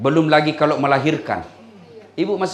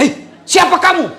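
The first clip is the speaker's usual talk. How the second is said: startled shout; 12 semitones higher, 5 dB louder than usual. Retold or imitated. Husband startled by giant.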